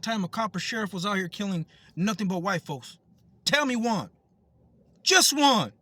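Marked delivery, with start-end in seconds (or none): 3.4-5.7 loud, high, forceful demand; real anger building to a shout; angry challenge. Real, not acted. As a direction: throw it out as a furious dare.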